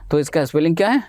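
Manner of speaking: usual speaking voice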